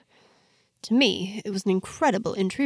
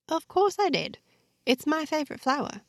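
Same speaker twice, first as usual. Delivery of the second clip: a lighter, higher voice for the heroine, a touch eager; heroine's voice, lifted higher; 8 semitones higher than usual. Acted. Heroine tells Rafa the lily is her favorite, in a higher voice. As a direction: answer brightly, as the young heroine. Heroine answers, surprised he noticed.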